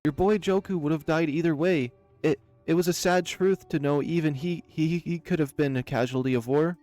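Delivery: nervous excuse. Acted. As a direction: stumble through excuses to save your skin. Cornered baron makes excuses.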